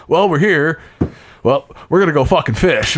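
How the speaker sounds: mock TV host itching to fish; Jeremy Wade. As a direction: blurt it like an excited host who just wants to fish. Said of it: "he's pretty much like".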